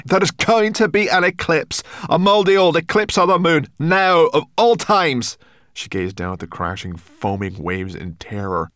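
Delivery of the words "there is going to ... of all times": loud, high indignant panic; exasperated high yelling; cried out. A narrator's reading. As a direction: cry it out, slowing hard at the end. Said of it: "cried Sorrel".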